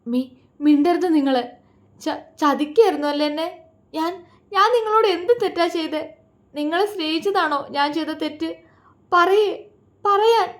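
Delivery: betrayed wife grabbing husband's shirt, furious; furious, tearful. A narrator's reading. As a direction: shake the answer out of him. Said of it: "ഷർട്ടിൽ കുലുക്കിക്കൊണ്ട് ചോദിച്ചു".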